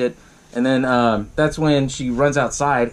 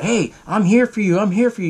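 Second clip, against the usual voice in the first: higher, eager mock reassurance; put-on pleading devotion, pitched up; obsessed best friend; 7 semitones higher than usual. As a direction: imitate a too-devoted friend insisting. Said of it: "playing like the obsessed best friend".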